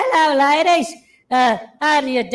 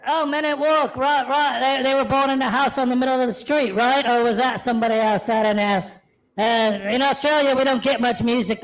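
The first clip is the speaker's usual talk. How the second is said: lower, put-on British-Australian accent; 5 semitones lower than usual. Accented man explaining music in Australia, lower British-Aussie accent. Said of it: "that and a British accent".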